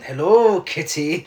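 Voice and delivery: mock Eastern European villain greeting; lightly put-on Eastern European accent, drawn out. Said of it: "can you do his accent?".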